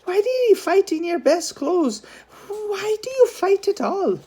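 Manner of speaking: put-on high, scolding young woman demanding answers; demanding sister. A narrator's reading. Older sister demanding answers from her brother, scolding. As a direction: press him like an exasperated older sister. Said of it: "Noreen demanded".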